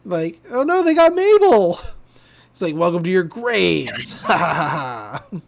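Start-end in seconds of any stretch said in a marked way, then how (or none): 0.5-1.8 high, loud cry of alarm; hammed-up panicked exclamation; mock panic. Teens see that they got Mabel, mock panic. Retelling the group's panicked reaction. 2.7-5.4 put-on villain welcome with evil laugh; mock-menacing proclamation, then cackle; evil masculine voice. Ghost welcomes teens to their graves, mock evil. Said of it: "an evil masculine voice is like".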